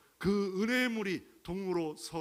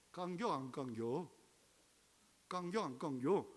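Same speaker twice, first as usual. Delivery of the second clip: put-on Chungcheong accent; 6 dB quieter than usual. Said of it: "충청도는 뭐라 말합니까".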